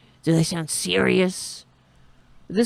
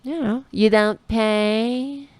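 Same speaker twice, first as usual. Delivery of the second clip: mock Trump taunt; 5 semitones higher, slower than usual. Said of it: "if we stop saying".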